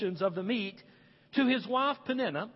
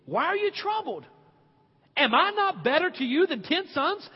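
husband pleading; 7 semitones higher than usual. Retold or imitated. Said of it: "He said".

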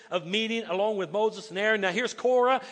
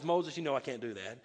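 lower, softer, patient voice; calm low God; 8 semitones lower, 7 dB quieter than usual. Retold or imitated. God calming angry Moses, lower and softer. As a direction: talk him down gently. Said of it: "And God says".

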